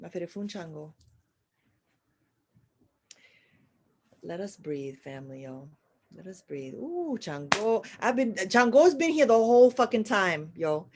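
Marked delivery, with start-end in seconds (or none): 0.0-6.7 much softer, settling the room; hushed. Real, not acted. Leading the family in a breath, very softly. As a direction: bring everyone down to a breath. Calming the room after shouting.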